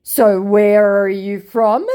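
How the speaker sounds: high, loud, drawn-out question; nosy scammer. Acted. Scammer asks her where she is from, slow put-on voice. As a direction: ask it slowly in a hammed-up put-on voice. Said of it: "he says".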